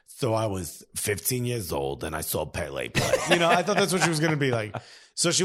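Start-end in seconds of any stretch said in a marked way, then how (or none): 0.1-3.1 put-on New York accent. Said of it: "I thought she was gonna be like".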